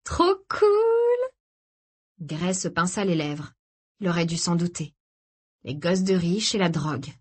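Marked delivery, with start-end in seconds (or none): 0.0-1.3 very high, drawn-out squeal of delight; excited. A narrator's reading. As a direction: stretch the last word in high excitement. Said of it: "Izzy se frotta les mains avec excitation". (enthousiasme suraigu, dernier mot étiré)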